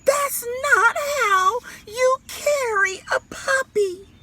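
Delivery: high, loud, wailing mother's scold; shrill-voiced mother hollering at her son; high, loud crying mama voice.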